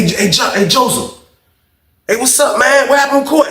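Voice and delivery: high-pitched call to get his attention. Retold or imitated. Inmate calls Joseph to ask about court, calling out. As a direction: hail him across the cell block, high and insistent. Calls to cellmate in retold story.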